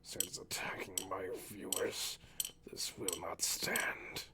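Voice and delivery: slow dramatic whisper. Acted. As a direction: vow it darkly and slowly. Mock-serious whisper vow.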